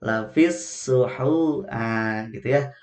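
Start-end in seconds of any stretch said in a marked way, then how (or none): 0.0-1.6 Lafis suhu chanted slowly, joined; slow Quranic chant; correct recitation. Shown as a demonstration. Teacher reciting the verse again, slow chant. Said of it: "langsung gabungkan".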